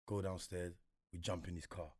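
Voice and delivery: ordinary talk, nothing marked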